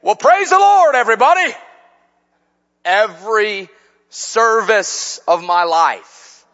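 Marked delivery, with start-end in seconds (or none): 0.0-1.5 higher, louder song-leader call in a West Virginia drawl. Retold or imitated. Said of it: "in that West Virginia drawl".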